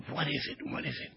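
hushed, bewildered questioning to himself; stunned whisper. Retold or imitated. Asking himself why the slap enraged him, whispered. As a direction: whisper it in shock after the rage. Stunned by his own rage.

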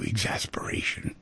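whispered, weary exasperation; exasperated sigh. Shown as a demonstration. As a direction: breathe the word out in a soft exasperated sigh.

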